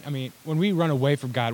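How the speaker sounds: normal talking voice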